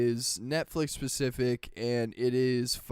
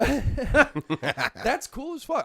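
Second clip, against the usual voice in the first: laughing, high and drawn out; 9 semitones higher, 4 dB louder, much slower than usual. Real, not acted. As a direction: laugh through the words. Excited laugh.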